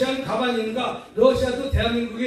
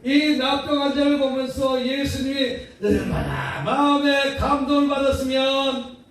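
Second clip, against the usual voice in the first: usual speaking voice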